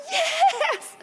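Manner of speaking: very high celebratory squeal; acted ecstatic yell; excited shout. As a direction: explode with secret delight. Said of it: "I was just so flipping excited".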